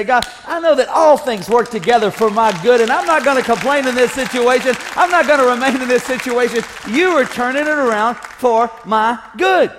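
loud, confident prayer of faith; bold declaration of praise. Acted. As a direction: declare it out over the crowd.

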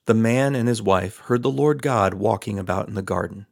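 usual speaking voice

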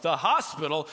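loud, taken-aback question; incredulous echo. Retold or imitated. Amateur dad answering his wife, incredulous. Echoes wife's decision in disbelief.

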